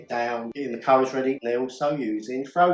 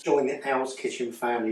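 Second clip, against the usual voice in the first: ordinary talk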